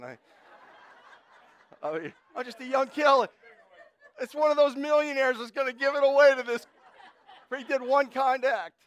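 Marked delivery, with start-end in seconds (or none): 1.8-8.7 loud, high-pitched comic excitement; mock astonishment. Preacher jokes to congregation about a young clerk's imagined windfall, hammed-up amazement. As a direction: exclaim it way up high, hammed-up for laughs.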